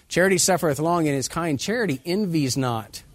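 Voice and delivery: booming King James reading; 1 Corinthians read aloud. Shown as a demonstration. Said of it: "reading scripture aloud with force".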